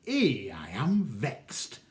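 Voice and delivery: vexed. Acted. Father's understated reply.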